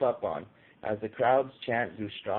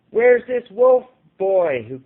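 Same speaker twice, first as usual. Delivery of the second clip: much higher, louder, slow taunting yell; crowd yell; 8 semitones higher, 12 dB louder than usual. A narrator's reading. Heckler in the crowd taunting the boy, loud yell. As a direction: jeer it across the crowd. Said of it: "someone in the crowd yelled out".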